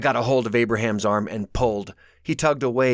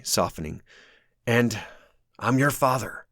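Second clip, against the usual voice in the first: ordinary delivery, nothing marked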